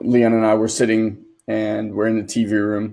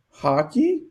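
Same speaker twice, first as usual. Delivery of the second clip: put-on much higher, unsure little boy asking; 13 semitones higher, 3 dB quieter than usual. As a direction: offer it like a hesitant kid. Child quoted.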